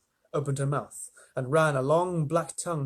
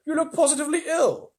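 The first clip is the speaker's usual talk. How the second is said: put-on much higher, louder old grasshopper; 13 semitones higher, 5 dB louder than usual. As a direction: fuss over the boy. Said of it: "the old green grasshopper asked".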